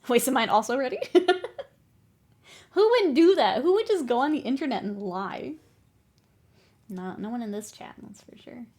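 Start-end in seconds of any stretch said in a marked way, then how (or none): 2.7-5.5 mock outrage. Streamer reacting to chat's supposed liar, mock outrage. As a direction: play up scandalised disbelief.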